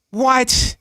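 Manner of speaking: reliving the moment of finding out; shocked disbelief. Retold or imitated. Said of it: "and I'm like".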